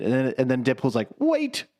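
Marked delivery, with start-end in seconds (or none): 1.2-1.6 sudden much higher mock Deadpool; Deadpool squeak. Deadpool voiced in retelling.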